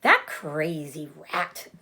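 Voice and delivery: slow, loud grumble at the rat; grumbling pig. Acted. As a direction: Wilbur fed up with the noisy rat. Wilbur grumbles about the rat.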